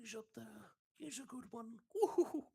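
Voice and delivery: a sweet Pooh bear, then a happy woo-hoo; Winnie the Pooh. Shown as a demonstration. Guest demos Pooh for the host in a high bear voice. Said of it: "Winnie the Pooh impression".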